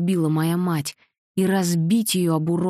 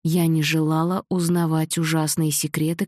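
ordinary delivery

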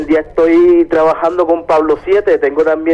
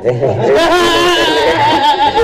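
laughing; 11 semitones higher than usual. Real, not acted. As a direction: burst out laughing. Caller laughs at joke.

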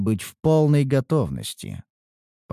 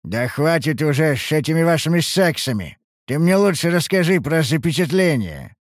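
exasperated; 6 semitones higher than usual. A narrator's reading. Hero exasperated by flirting.

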